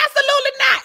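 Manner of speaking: high, loud shutdown; retold indignant outburst; emphatic refusal. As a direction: refuse point-blank at full voice.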